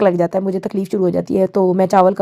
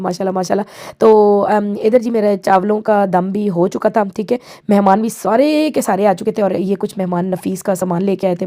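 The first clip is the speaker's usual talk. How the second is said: usual speaking voice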